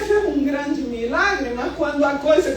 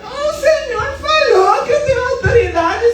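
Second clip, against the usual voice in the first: high, laughing, half-crying plea; hammed-up alarm with a giggle; laughing panic; 9 semitones higher, slower than usual. Wife retelling the storm to the church, laughing mock panic.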